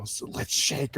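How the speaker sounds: soft, breathy game announcer line; imitating the voice announcing the fight. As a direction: lightly voice the game's announcer. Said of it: "the voice comes over and says".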